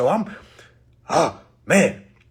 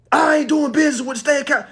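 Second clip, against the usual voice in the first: fast, higher, louder legal chant; rapid declaration; 8 semitones higher, 6 dB louder, faster than usual. Retold or imitated. Quoting his speech to viewers, fast and forceful. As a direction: rattle it off like a creed. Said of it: "from my chest".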